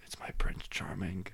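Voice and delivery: whispered teasing aside; whispered joke. Retold or imitated. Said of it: "make a comment like".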